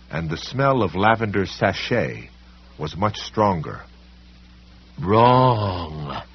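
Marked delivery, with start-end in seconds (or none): 5.0-6.2 crying out. A narrator's reading. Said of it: "the library policeman cried".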